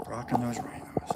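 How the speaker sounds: breathy whispered aside; soft unvoiced murmur. Real, not acted. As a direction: whisper it to the chat like a secret.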